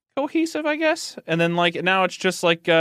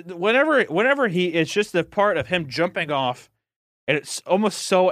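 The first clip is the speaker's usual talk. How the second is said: usual speaking voice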